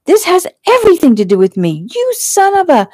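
high, loud tirade, peaking on the insult; screaming fury. A narrator's reading. Fiancée rages at him down the phone, screaming. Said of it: "She was screaming now".

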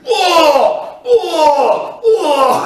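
repeated high pump-up roars; loud drawn-out psyching-up yells; re-enacted screaming. Athlete pumping up backstage, re-enacted screams. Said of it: "dann habe ich rumgeschrien".

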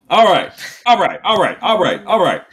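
ordinary talk

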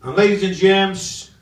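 loud, slow announcement to the crowd; showman's call to the audience. Real, not acted. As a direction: boom it out to the room. Comedian calls out to crowd.

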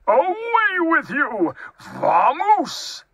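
high-pitched shout of an exasperated bear; angry dismissal. A narrator's reading. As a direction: shoo the intruder away at full pitch. Angry bear orders mouse out.

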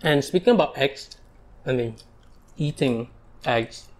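chewing while talking; talking between bites; mouth full. Real, not acted. Seen 3: Spoon of food into mouth at 1.3; chewing visible through 5.0.

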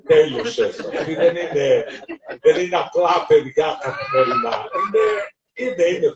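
loud laughing warning, building up. Retold or imitated. Priest warns preacher about kids. (δυνατή προειδοποίηση με γέλιο)

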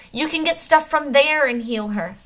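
high and loud, pleading; almost shouting. A narrator's reading. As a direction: push it out, nearly yelling. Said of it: "he says, almost shouting".

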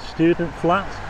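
normal talking voice

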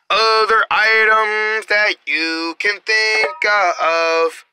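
hushed, drawn-out commercial voice. Acted. Reads a slow commercial.

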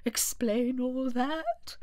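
very slow, drawling turtle voice; Mock Turtle voice. A narrator's reading. Mock Turtle asking Alice to explain, very slow. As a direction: drag the request out.

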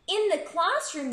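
ordinary delivery

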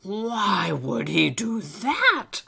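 put-on baffled old elf. Old elf challenges Alistair's fear, baffled. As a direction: ask with big drawn-out puzzlement.

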